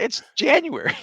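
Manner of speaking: very high mimicked protest; exaggerated high-pitched retort; indignant imitation. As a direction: squeal it as a mock partner's protest. Retold car heat quarrel, imitating partner.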